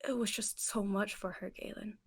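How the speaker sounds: soft sorrowful whisper. Acted. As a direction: breathe it out, barely voiced. Grieving aunt repeats, unvoiced.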